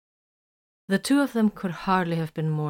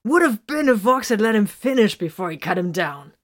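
louder, grinning tease; provocative grin; 4 semitones higher, 6 dB louder than usual. A narrator's reading. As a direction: needle them with a grin. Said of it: "grinning provocatively".

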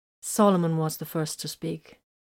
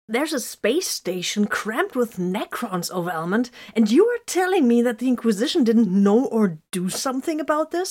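high, outraged, incredulous challenge; indignant disbelief; 6 semitones higher, 5 dB louder than usual. Acted. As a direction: build from incredulous to louder, high-pitched outrage. Acted quarrel, Solomon challenges Vox.

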